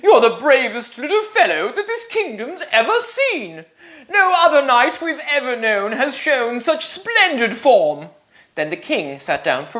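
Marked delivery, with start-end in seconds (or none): 0.0-8.1 high, laughing with delight; joyful praise. Acted. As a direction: praise the hero, beaming. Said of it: "rejoiced the king and queen".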